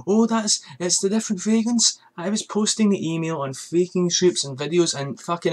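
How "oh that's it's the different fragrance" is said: pitched up, mocking the doubters; high mocking voice. Ranting about doubters, mimicking them in a high mocking voice. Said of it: "people were still like".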